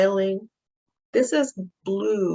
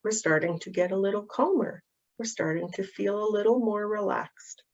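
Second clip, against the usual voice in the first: put-on softer, soothing voice leading a child through calming down; guided calming; 5 dB quieter than usual. Shown as a demonstration. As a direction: talk the child gently back down the thermometer. Leads a calming exercise.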